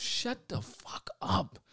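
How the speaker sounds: slow, hissed whisper; whispered hushing. Real, not acted. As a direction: whisper it slowly to shut up an interrupter. Hushing co-host who interrupts his disclaimer.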